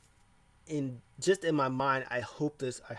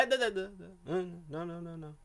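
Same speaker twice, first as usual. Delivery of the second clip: mock scolding; 5 semitones higher than usual. Alexa stopping Asuka from taking a swing, mock scolding. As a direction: imitate someone fussily stopping a person.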